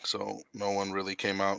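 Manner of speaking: talking through a burp; words cut by a burp. Real, not acted. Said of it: "I'm sorry, I, like, was burping".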